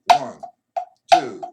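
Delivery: every count shouted loud; loud counting on every beat. Shown as a demonstration. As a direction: bark out each beat like a drill. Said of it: "to hit the accent".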